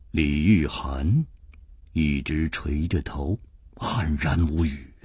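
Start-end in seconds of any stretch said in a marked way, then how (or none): none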